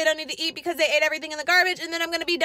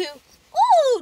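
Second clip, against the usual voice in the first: impressed ooh; 10 semitones higher, 5 dB louder than usual. Real, not acted. Rating her day from meh to great.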